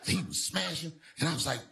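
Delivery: usual speaking voice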